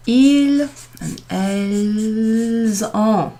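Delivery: ils and elles ont said very slowly, the s linked as a z into ont; slow liaison demo. Shown as a demonstration. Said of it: "the S is pronounced in front".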